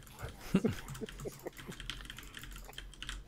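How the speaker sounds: quiet, breathy chuckle; soft laugh. Real, not acted. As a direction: chuckle softly to yourself. Laughs at own mistake.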